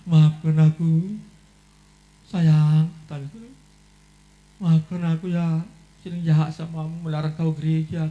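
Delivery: a husband's tearful sorry; tearful apology. Retold or imitated. Husband apologizes to wife, slow and pleading. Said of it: "Menangis dia".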